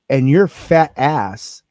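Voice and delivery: slow, loud, each word spaced out; slow, deliberate loud insult at the boss; retold loud telling-off. To the captain in the meeting, slow loud retold insult. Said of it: "I said just like this".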